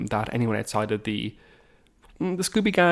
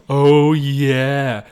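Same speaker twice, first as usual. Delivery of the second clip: mock heavy sarcasm; 3 semitones higher, 8 dB louder, much slower than usual. Imitating the school's most sarcastic person, loud exaggerated sarcasm. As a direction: stretch every word, dripping with exaggerated sarcasm. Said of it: "most sarcastic was kind of person who would be going around being like".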